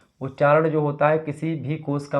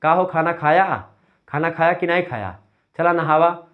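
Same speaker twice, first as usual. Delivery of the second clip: सुल्तानपुर की अवधी बोली में; Sultanpur Awadhi; 3 semitones higher, 5 dB louder than usual. Shown as a demonstration. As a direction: call out like a Sultanpur villager. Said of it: "Sultanpur में जाएंगे".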